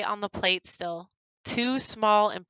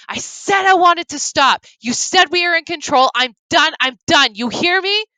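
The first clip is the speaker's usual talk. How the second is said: loud, much higher enraged yelling; furious shout; 9 semitones higher, 10 dB louder than usual. Acted. Grum shouting at the captors while throwing remains. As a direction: scream your defiance at them.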